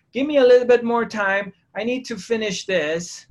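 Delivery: high, urgent bargaining; mock pleading.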